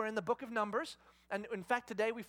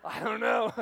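feigned ignorance; 9 dB louder than usual. Retold or imitated. Dad disowns child in store.

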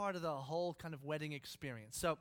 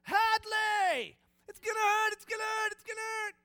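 imitated screaming; 13 dB louder than usual. Imitated daughter's endless screaming.

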